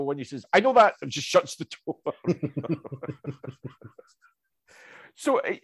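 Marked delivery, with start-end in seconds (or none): 0.5-0.9 sharp, higher, louder comeback; curt retort. Retold or imitated. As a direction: snap it back dismissively. Retold man snaps back at courier.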